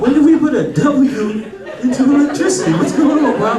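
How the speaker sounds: high-pitched, incredulous outburst; exasperated disbelief. Retold or imitated. Comic baffled at his friend's mistake, exasperated. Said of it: "it pissed me off so much".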